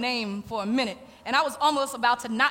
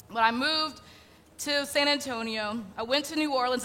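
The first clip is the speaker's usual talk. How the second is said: ordinary delivery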